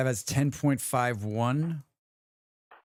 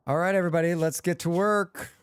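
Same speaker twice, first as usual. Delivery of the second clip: high, bright boss call to work; rally the crew like a foreman; rallying leader; 5 semitones higher than usual. Acted. Rallying colonists in game.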